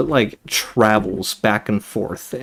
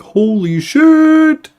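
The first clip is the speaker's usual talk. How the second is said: drawn-out, much higher and louder holy shit; stunned shout; 7 dB louder, much slower than usual. Real, not acted. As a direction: blurt it out amazed, stretching every sound. Streamer reacts to big streak.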